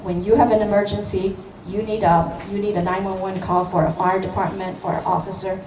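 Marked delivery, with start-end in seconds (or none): none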